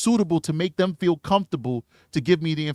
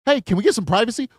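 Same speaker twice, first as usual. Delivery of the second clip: mock blunt demand; 7 semitones higher, 6 dB louder, faster than usual. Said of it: "That's not the right verbiage".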